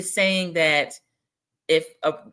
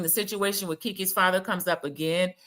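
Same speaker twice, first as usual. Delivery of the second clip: usual speaking voice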